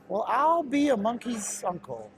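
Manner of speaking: high put-on old-timer exclamation; mock country old-timer voice; folksy exclamation.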